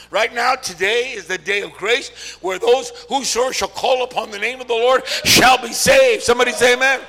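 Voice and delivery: loud preaching that builds to a shout; full-force preaching. Real, not acted. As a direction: build to the climax, then call for the amen.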